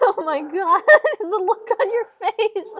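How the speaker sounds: loud, high, helpless laughter through the words; real laughter at her own scream; laughing hard. Real, not acted.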